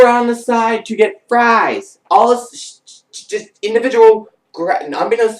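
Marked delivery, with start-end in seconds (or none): none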